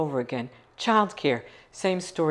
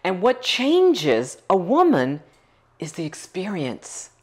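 slow emphasis; 3 semitones higher, slower than usual. Real, not acted. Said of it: "slow emphatic repeated point".

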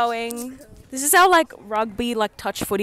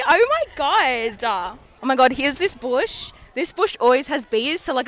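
normal talking voice

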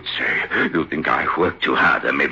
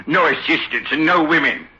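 normal talking voice

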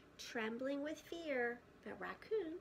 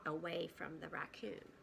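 usual speaking voice

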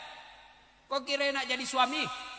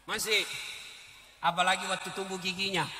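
ordinary talk

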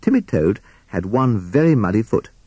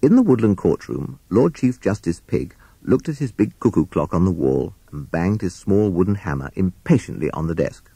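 plain reading voice

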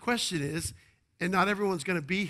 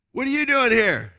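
higher, loud reporter prodding; mock reporter; 8 semitones higher, 10 dB louder than usual. Pastor acting the interviewer again, high loud probing. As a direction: push the question at the protester. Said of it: "People were interviewed in the first march".

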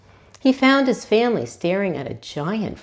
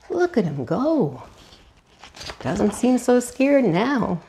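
usual speaking voice

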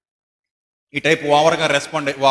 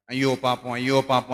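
pitying sigh; 6 semitones lower than usual. Pretend. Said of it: "అని జాలి పెంచుకోవాలి".

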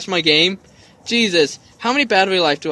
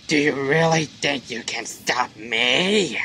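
low, softer taunting villain voice; mocking villain; 3 semitones lower, 5 dB quieter, slower than usual. Player voicing the villain scoffing at the hero, mocking. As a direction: sneer it slowly with a little giggle.

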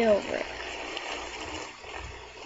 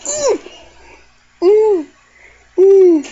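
excited ooh; 5 semitones higher, 11 dB louder, slower than usual. Real, not acted. Watching a dive.

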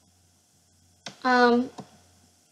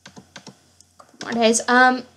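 ordinary delivery

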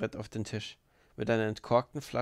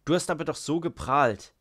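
loud, taunting reproach; raised voice, goading; shouting; 4 semitones higher, 7 dB louder than usual. A narrator's reading. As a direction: shout it as a jeer. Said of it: "schrie Rakitin".

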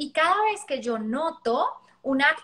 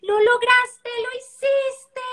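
much higher, louder, slow joyful celebration; celebrating with child; 11 semitones higher, 6 dB louder, much slower than usual. Shown as a demonstration. Parent celebrating child's success, joyful and slow. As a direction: cheer it with the child, sharing the win. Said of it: "lo que le quiero decir es".

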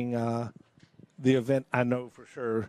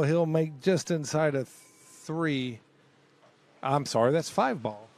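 ordinary delivery

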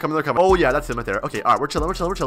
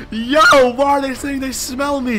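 higher and louder, rising alarm; panicked; 8 semitones higher, 3 dB louder than usual. Real, not acted. Player realising the girls can smell him, panicked. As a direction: blurt it as panic sets in.

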